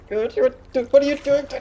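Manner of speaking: high, panicked sputtering; embarrassed sailor panicking; gibbering sailor. Acted. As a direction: sputter in shock at her. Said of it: "he's gibbering".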